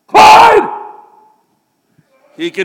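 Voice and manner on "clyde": huge drawn-out call, much higher and louder; calling the dog. Shown as a demonstration. Preacher shows the congregation how he called his dog home, full shout. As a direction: send the name down the whole street. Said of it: "And this is my voice".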